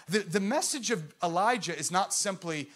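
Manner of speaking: ordinary delivery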